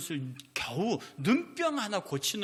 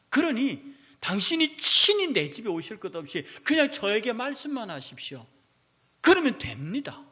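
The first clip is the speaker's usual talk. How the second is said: louder, higher earnest pleading as the centurion; preaching at full force; 4 semitones higher, 7 dB louder than usual. Acted. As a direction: preach the centurion's plea out loud and fervent. Preacher voices the centurion's plea.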